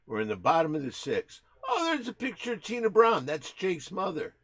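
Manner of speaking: excited sportscaster calling the game; hammed-up baseball announcer; mock play-by-play. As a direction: call the inning like a live broadcast.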